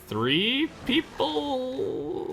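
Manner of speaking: high, very slow, stretched-out THREE PEOPLE; long, lingering high-pitched delivery; drawn-out words. Real, not acted. Playfully stretching out the recommendation.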